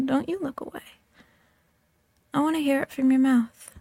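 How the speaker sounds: usual speaking voice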